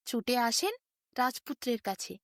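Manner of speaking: usual speaking voice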